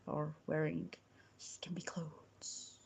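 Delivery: slow breathy whisper. Acted. Streamer teasing chat, in a slow whisper. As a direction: drop to a slow secret whisper.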